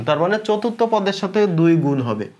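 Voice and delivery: normal talking voice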